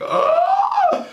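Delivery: sudden high scream; startled scream. Acted. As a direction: shriek in fright. Scared by empty driver's seat.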